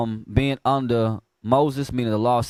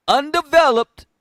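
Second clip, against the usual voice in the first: emphatic preaching; 11 semitones higher, 6 dB louder than usual. Acted. Emphatic preaching of quoted word.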